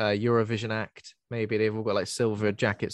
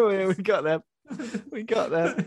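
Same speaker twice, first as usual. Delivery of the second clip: laughing mock reply; 8 semitones higher than usual.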